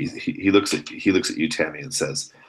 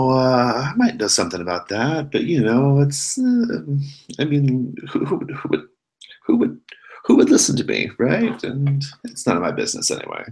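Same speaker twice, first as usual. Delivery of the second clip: evasive, halting, pitch jumping up; hesitant dodging; 9 semitones higher, 4 dB louder than usual. Acted. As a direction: stammer and hedge like a reluctant informant. Reluctant informant dodging the question.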